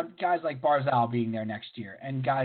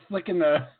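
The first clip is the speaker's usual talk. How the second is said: ordinary talk, nothing marked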